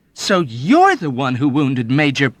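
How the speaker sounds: loud indignant exclamation; young officer accusing the old man; horrified indignation. A narrator's reading. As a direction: accuse him, appalled. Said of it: "he exclaimed in horrified indignation".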